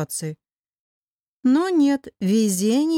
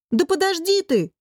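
high, irritated snap; snarled; 8 semitones higher than usual. A narrator's reading. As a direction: cut off a pestering cat. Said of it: "рыкнули на него вдвоем". (раздражённо рявкнув, высоко)

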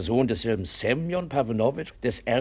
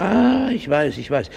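higher and louder, a know-it-all's burst; eager recognition; 5 semitones higher, 6 dB louder, slower than usual. Acted. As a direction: jump in, delighted to know him. Busybody eager to show knowledge. (eifrig, höher und lauter: ich weiß)

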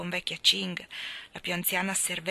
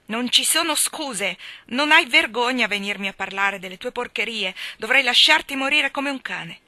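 furious scolding; 4 semitones higher, 8 dB louder than usual. A narrator's reading. Old servant scolding furiously.

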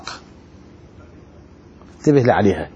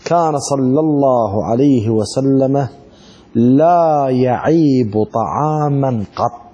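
slow reverent recital; 4 semitones lower, much slower than usual. Shown as a demonstration. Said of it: "slow recitation of hadith".

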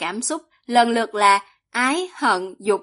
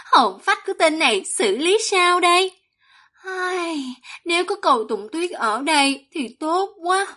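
voiced female cultivator; 6 semitones higher than usual. A narrator's reading.

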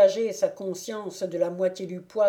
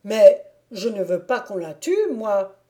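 louder, stubborn protest; a woodcutter defending his goat, mildly heated; indignant refusal; 7 dB louder than usual. A narrator's reading. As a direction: plant your feet and refuse.

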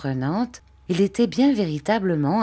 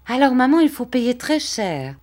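put-on higher, louder boy's voice; excited child; 9 semitones higher, 5 dB louder than usual. A narrator's reading. Boy telling his mother it will cost dear, excited. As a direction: pipe up eagerly like a child.

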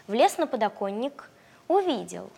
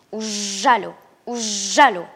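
bee voice; 7 dB louder than usual. Acted. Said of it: "жужжит".

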